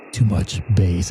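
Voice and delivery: deep bassy voice. Shown as a demonstration. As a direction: drop it low, like a speaker with too much bass.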